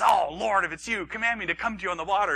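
eager call. Acted. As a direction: bold Peter calling out eagerly. Bold Peter calls to Jesus.

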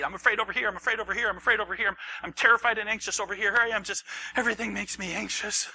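high, loud anxious wail; mock panic. Preacher acts anxious disciples.

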